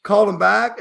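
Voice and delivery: ordinary talk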